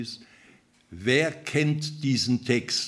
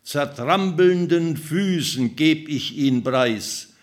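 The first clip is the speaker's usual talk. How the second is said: louder, higher declaiming of the vineyard song; the vineyard owner calling out to the people of Judah; dramatic poem reading; 4 semitones higher, 7 dB louder than usual. Acted. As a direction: proclaim it like a prophet's lament. Reads Isaiah vineyard song aloud.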